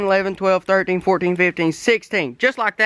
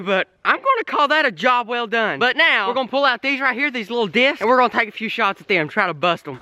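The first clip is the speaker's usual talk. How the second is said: ordinary delivery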